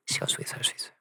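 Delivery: hushed, very soft whisper; whispered repeat. Real, not acted. Preacher repeating to congregation what time allows, whispering. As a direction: drop to a whisper to let it sink in.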